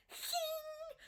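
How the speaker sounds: tiny squeaky whimper, very high and soft; a mock frightened whine from her anxiety; anxious whimper. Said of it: "my anxiety will be like".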